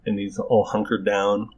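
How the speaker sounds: ordinary talk, nothing marked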